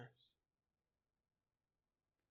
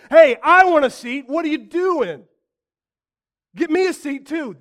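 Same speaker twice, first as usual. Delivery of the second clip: higher, louder, pushy grab for a seat; mock demanding shout; 10 semitones higher, 17 dB louder than usual. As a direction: play the greedy disciples yelling for a place. Disciples voiced demanding seats.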